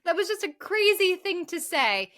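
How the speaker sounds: usual speaking voice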